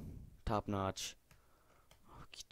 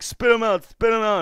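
demanding; 7 semitones higher, 9 dB louder than usual. Retold or imitated. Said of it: "and I'm like".

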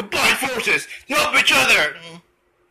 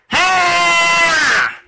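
long, high held jump yell; effort cry; 5 semitones higher, 3 dB louder than usual. Shown as a demonstration. [name] teaching the leg move, long high effort cry. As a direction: hold a high yell mid-leap.

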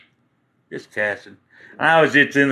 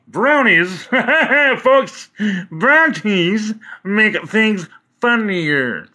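slow, stretched and higher, amused; giggly drawn-out; 7 semitones higher, much slower than usual. Real, not acted. Telling viewers brownies make things funnier, slow amused drawl. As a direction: savour each word like an inside joke.

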